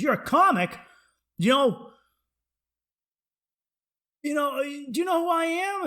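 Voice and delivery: high, loud mock astonishment; imitated self-important stranger. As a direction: pitch it up, playing the big shot. Acted man boasting to comic.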